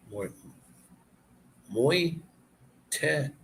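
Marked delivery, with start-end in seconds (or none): 1.7-3.3 moite-tie drawn out in separate slow syllables. Shown as a demonstration. Learner works out a French word, slowly syllable by syllable. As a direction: break moite-tie into slow pieces, pausing between. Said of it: "It's pronounced".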